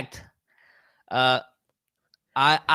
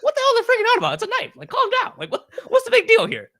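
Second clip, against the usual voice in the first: much higher, louder exasperated laughing rant; mock incredulous outrage; 6 dB louder, faster than usual. Traveler grumbling at security, high exasperated mockery. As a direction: play your past self as baffled and put out. Mocking his own clueless outrage.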